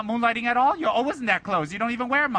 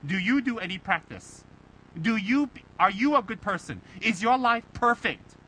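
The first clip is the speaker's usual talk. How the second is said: usual speaking voice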